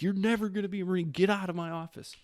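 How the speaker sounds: high, fast imitation of a chewing-out; retold angry tirade. Recruiter throws the recruit out of his office, mock-angry tirade. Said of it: "he just laid into me for fifteen minutes".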